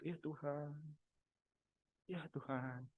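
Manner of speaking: very soft, pleading moan; pleading sigh. Shown as a demonstration. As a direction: plead softly, as if begging. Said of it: "Pegang Alkitab". (rintihan memohon yang sangat lirih)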